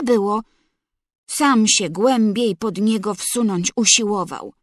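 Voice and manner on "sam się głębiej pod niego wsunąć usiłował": ordinary delivery, nothing marked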